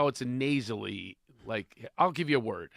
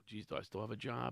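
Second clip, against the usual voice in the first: softer, lower worried aside; sheepish retold aside; 4 semitones lower, 12 dB quieter than usual. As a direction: say it small, as if you blundered. Retold sheepish aside after his gaffe.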